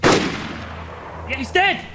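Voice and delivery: sudden high excited shout; excited yell. Real, not acted. Gamer yelling to teammates that the enemy is dead. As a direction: cheer the kill, pitch jumping up.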